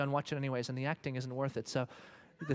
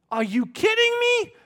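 much higher, shouted; shouted wife's retort; 11 dB louder, slower than usual. Pretend. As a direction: shout it as the proud wife. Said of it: "she will look you in the eye and say".

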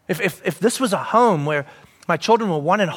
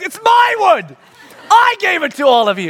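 exasperated shout; 8 semitones higher, 3 dB louder than usual. Retold or imitated. Exasperated father.

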